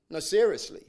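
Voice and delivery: normal talking voice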